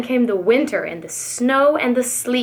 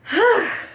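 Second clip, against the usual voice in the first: long bored sigh up high; weary sigh; 9 semitones higher than usual. A narrator's reading. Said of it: "Sighed Maisie".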